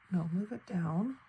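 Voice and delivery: ordinary talk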